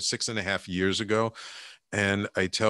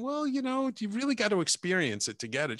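coaxing, pitched up; 11 semitones higher than usual. Retold or imitated. Coaxing wife, retold.

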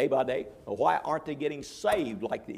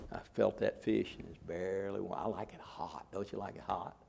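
ordinary talk, nothing marked

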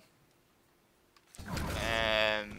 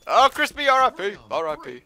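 excited mock mourning; 8 semitones higher, 9 dB louder than usual. Real, not acted. Teammate Crispy just died.

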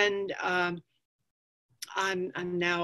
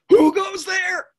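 high, loud challenge from below; troll-like challenge; 13 semitones higher, 9 dB louder, slower than usual. Acted. Voice under the bridge challenging a goat, loud and high. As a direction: call it out as a fierce bridge guard. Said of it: "a voice from under the bridge".

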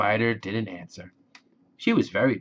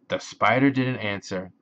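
ordinary delivery, nothing marked

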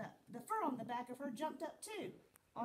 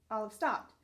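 plain reading voice

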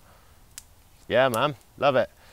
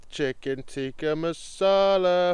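hammed-up higher menu announcer; mock dinner announcement; 3 semitones higher than usual. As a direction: present the menu like a grand reveal. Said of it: "Drum roll, please".

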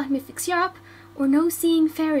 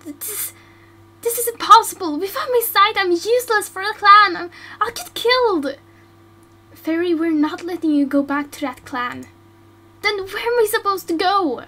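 higher, louder anguished pleading; distraught; 5 semitones higher, 6 dB louder than usual. Acted. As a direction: despair about the clan. Despair at blindness.

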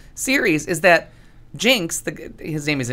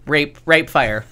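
ordinary talk, nothing marked

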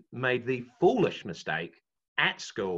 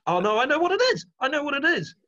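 ordinary talk, nothing marked

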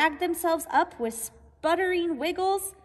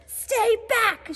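higher, louder shout; squirrel shouting; 5 semitones higher, 4 dB louder than usual. Acted. Bruce the squirrel warning Searle away, loud high shout. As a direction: bark it out to keep the rival off. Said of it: "shouted Bruce".

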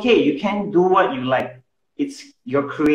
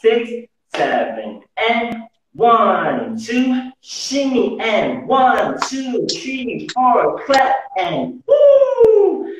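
counting workout reps; 7 semitones higher, much slower than usual. Shown as a demonstration. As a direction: call out the steps to the beat. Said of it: "teacher counts the dance steps".

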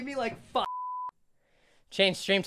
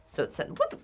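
normal talking voice

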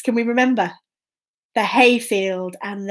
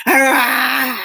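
long playful ogre roar; drawn-out pretend ogre noise; ogre growl; 4 dB louder than usual. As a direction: growl like a grumpy storybook ogre. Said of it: "can we do our best ogre noises".

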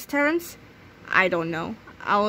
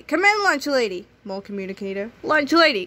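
usual speaking voice

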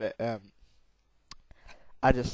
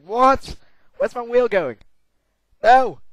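high, loud shocked yell; sudden panicked shout at the game; shocked outcry; 10 semitones higher, 13 dB louder, slower than usual. Real, not acted. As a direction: scream in alarm as the wheel flies off.

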